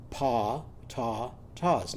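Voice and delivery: pa, ta, tas said as separate syllables with ah; syllables broken apart. Shown as a demonstration. Teacher modelling pa ta tas syllable by syllable. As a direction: tap out pa ta tas.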